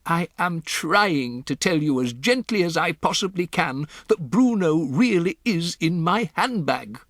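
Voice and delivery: put-on a composed old grandmother breaking odd news; grandmother's voice. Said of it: "my grandmother said".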